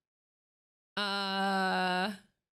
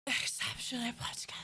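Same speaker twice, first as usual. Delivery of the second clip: fast, dry whispered aside; quick ironic whisper to chat; sarcastic whisper; 5 dB quieter, much faster than usual. Real, not acted. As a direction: whisper it quickly, dripping with irony. Sarcastic aside about old self.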